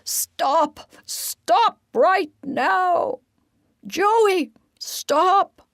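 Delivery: high, loud yell; angry rat yelling at Joey; yelling stop. A narrator's reading. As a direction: shout him down from the chimney, high and loud. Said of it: "is and yells".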